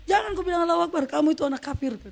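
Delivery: quick, high-pitched rebuke; high mock scolding; retold scolding. Father scolding the beaten child.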